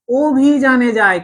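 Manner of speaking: অভিযানে said with a long round opening "o"; o-sound demo. Shown as a demonstration. Teacher showing students how অভিযানে is pronounced, slow long "o". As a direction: draw out অভিযানে slowly, starting on "o". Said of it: "ও এর মতো".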